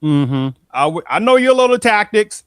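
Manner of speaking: loud knowing "mm-hmm" then a pointed jab; knowing callout. Retold or imitated. Calling out opponents' tactics with a loud knowing tone. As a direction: call out their tactics, loud and sure. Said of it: "You're like".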